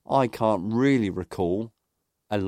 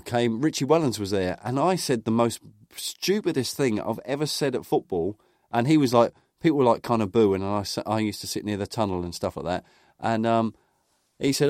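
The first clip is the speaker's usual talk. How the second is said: usual speaking voice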